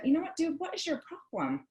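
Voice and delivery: retold wifely exasperation; exasperated confrontation. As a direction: start high and fed up, then drop on the question. Confronts annoyed husband, retold.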